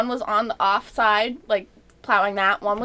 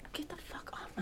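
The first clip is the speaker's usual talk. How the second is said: whispered; 5 semitones higher, 22 dB quieter than usual. Retold or imitated. Retold road rage, whispered.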